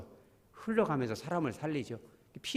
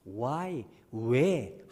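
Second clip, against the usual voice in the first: ordinary talk, nothing marked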